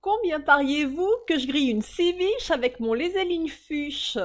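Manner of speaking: voix de gouailleuse, haute et forte; défie la salle avec aplomb; défi gouailleur. Acted. La môme lance un défi. (gouaille haute et forte)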